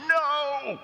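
high, drawn-out yelled refusal; hammed-up motherly shout; mom's shout. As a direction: yell it high like a fed-up mother. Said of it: "And she shouts".

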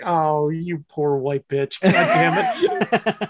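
teasing pity that breaks into a laugh; mock pity. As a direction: friend pitying with a laugh, drawn out. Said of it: "And you're like".

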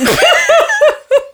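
high cackling laugh; witch cackle. Real, not acted. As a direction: cackle like a hag. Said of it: "My cackle ruined it".

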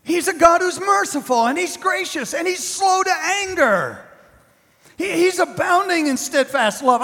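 high, pressing imitation of the false prophets; mock-insistent prophets. Preacher mimicking the false prophets, high and insistent. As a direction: plead your case smugly. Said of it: "They say".

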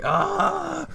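shrill goat scream, very high. Retold or imitated. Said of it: "Like a screaming goat".

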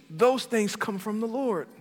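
normal talking voice

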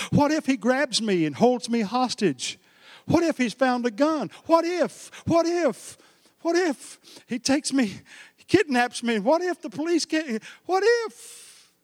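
fretful woman talking herself into terror; mounting panic. Retold or imitated. Panicked woman's rising what-ifs.

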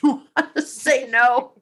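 half-laughed, rising into an unsure question. Shown as a demonstration. Coach mimicking someone unsure of their no, questioning. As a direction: let the end lift as if doubting yourself. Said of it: "as if you're not even sure yourself".

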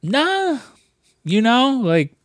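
mock owner shrugging off trouble; carefree owner. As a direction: wave it off, all is fine. Owner brushing off the chef.

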